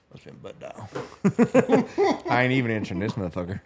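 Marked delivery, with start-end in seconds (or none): 0.1-0.9 whispered under breath; whispered aside. Retold or imitated. Retold thought on seeing call. 0.9-2.2 sharp laugh rising up; burst of laughter. Real, not acted. Laughs after the quote.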